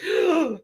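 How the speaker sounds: a high, exaggerated shocked gasp; mocking gasp. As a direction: gasp in fake outrage, high. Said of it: "people on Twitter going like".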